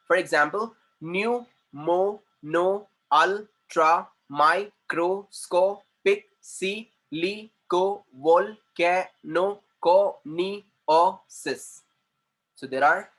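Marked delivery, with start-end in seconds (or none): 1.0-11.8 "new mono ultramicroscopic silicovolcanoconiosis" very slowly, each syllable apart. Shown as a demonstration. Teacher counting out syllables for class, "new mono ultramicroscopic silicovolcanoconiosis" slowly chopped apart. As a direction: break "new mono ultramicroscopic silicovolcanoconiosis" into nineteen separate beats. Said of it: "nineteen syllables we have in this word".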